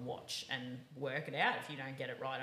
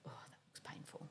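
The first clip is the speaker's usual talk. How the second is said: whispered aside; 14 dB quieter than usual. Retold or imitated. Host reacts to stiff horses on the flat, whispered wince. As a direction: whisper it, wincing.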